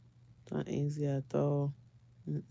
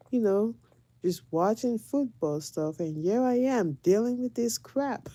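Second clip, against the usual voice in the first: higher-pitched exasperated rant at a husband; worked-up retold scolding, pitch raised; retold cussing; 7 semitones higher, 4 dB louder than usual. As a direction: tell him off, reenacting the outburst. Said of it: "was just cussing at him".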